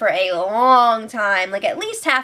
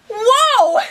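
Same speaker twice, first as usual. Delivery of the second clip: stunned, then laughing; shocked whoa. Real, not acted. Reacting to a crude insult.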